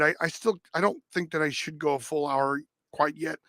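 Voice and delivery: ordinary delivery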